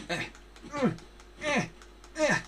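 high strained fighting grunts; effort grunts. Acted. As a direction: grunt hard while swinging at the bush. Fighting the bush.

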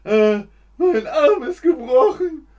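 high, loud laughing wail of pain; mock pained wail. Teacher acts a broken arm, wailing half-laughing. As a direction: ham up a broken-arm cry.

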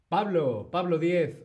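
loud call of a name from the chat. Real, not acted. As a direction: call Pablo out loudly. (llamando fuerte a Pablo)

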